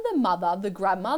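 usual speaking voice

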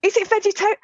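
high, disbelieving insistence; mock incredulous teen. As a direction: press her in disbelief. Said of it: "I said".

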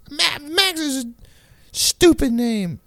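high, whiny mock-kid objection; Jaden. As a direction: imitate a sulking kid, squeaky and dragging out the end. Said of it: "like a kid logic".

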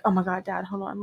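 quick hushed aside into the AirPods. Retold or imitated. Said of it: "I was like".

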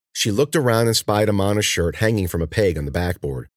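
plain reading voice

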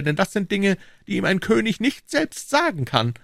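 high, lofty royal voice; a king speaking down to his subjects; king's voice. Acted. King to Treville, high lofty royal voice.

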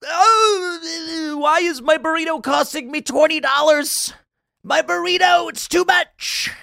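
high, whiny mock complaint; whiny Biden voter. Host mocks a Biden voter paying for a burrito, high whiny parody. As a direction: whine it like a hammed-up voter shocked at the price. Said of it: "are now like".

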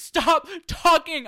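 high, loud, slow snap; hammed-up high command; mock yell. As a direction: bark it high and drawn out. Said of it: "she's like".

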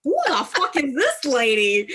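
high, laughing what-is-this; laughing disbelief. Retold or imitated. Friend retelling a mafia romance, laughing in disbelief. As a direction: laugh through the question in disbelief. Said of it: "And I'm like".

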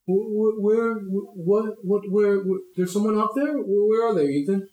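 bewildered sputtering search; Captain Wayne. Acted. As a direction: stumble over the questions, flustered. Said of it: "Captain Wayne kinda comes out".

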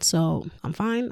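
usual speaking voice

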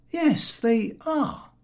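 drawn-out testy yes; George's reply. A narrator's reading. George snapping at Harris, slow and high. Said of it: "said George".

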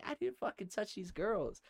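high-pitched indignant denial; retold protest. Accused man to the group on the hill, acted high protest. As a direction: protest your innocence, acted out.

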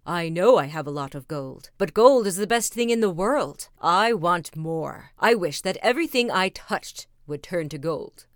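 loud, insistent greedy king. Acted. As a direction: demand it like a king who wants everything.

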